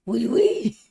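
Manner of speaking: loud, high French squeal; hammed-up French exclamation; mock French. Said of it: "Is it French or am I tripping?".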